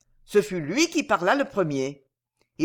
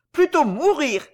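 high, loud cry of indignation; indignant outburst; 7 semitones higher, 5 dB louder than usual. A narrator's reading. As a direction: exclaim it in a burst of outraged refusal. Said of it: "s'écria-t-elle dans un élan d'indignation". (cri d'indignation)

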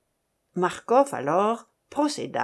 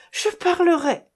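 high, loud cry of giving in; panicked surrender; 8 semitones higher, 5 dB louder than usual. A narrator's reading. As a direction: yield in a high, urgent voice. Tortured man gives in. (capitulation affolée, voix aiguë)

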